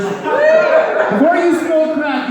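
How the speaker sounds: slow hammed-up pitch voice; mock announcer shout. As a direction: bellow it like a pitchman.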